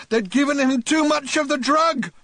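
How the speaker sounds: a frantic shout at those by the bed. Acted. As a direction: yell it at them from his elbows. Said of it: "shouted at them".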